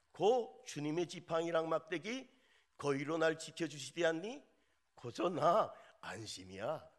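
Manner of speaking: put-on playful North Korean Pyongan accent; 평안도 사투리로 장난스럽게; Pyongan dialect. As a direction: read the psalm like a folksy Pyongan elder. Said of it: "평안도 버전입니다".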